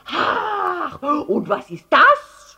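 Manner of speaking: high, slow, loud cry; triumphant yell. A narrator's reading. Herr Letscher spotting the elephant's bottom, yelling. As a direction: shout it as if catching them out. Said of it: "schrie Herr Letscher".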